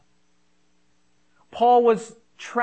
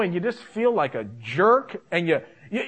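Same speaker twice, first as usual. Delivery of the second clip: normal talking voice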